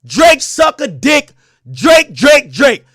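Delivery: loud, slow, jeering shout of the name; mock diss shout. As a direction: bark it out like a battle-rap taunt. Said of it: "let him say".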